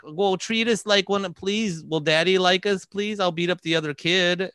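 high, needy begging to daddy; pleading child. Acted. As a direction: plead like a kid desperate to be liked. Voices needy child begging for approval.

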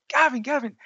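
high, loud calls of Gavin; mother calling her son's name down the line; mum shouting. Retold or imitated. Mum shouting for her son on the phone, high and loud. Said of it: "she started shouting".

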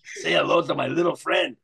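a light Scarface gangster voice; a hint of Al Pacino holding the gun; Al Pacino impression. Quoting the famous Scarface line in a light Al Pacino voice. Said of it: "Al Pacino".

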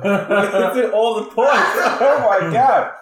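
high, loud and laughing; laughing delight. Real, not acted. Host thrilled the talk hits every point, laughing excitedly. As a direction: shout it happily, breaking into laughter.

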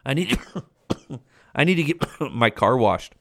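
talking while smoking, coughing; coughing through a smoke; cigarette in mouth. Real, not acted. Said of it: "let me take a puff puff".

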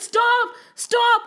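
high-pitched plea to stop; desperate begging. Acted. Kalia begs the dancing Krishna, high and desperate.

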